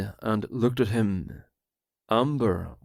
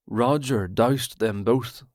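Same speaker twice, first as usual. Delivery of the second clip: ordinary delivery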